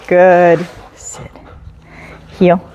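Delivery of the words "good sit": ordinary talk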